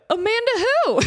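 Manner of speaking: high, loud thrilled squeal; squealing excitement. Retold or imitated. Fan hears a surprise call from her idol, squealing. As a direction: ask it breathless with delight. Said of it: "And I'm like".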